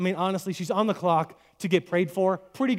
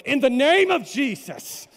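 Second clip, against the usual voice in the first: slow, high, loud showy prayer cry; mock preacher yell; 8 semitones higher, 7 dB louder, much slower than usual. Man ambushing a stranger in Walmart, mock preacher yell. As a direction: belt it out like an over-the-top street preacher. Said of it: "When has yelling made anything better".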